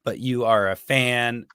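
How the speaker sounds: ordinary talk, nothing marked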